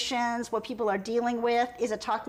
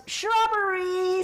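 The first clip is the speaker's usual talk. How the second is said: slow, higher "Shrubberies."; hammed-up comedy voice; mock silly voice; 8 semitones higher, 3 dB louder, much slower than usual. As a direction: draw it out in a goofy voice. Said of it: "Monkey goes".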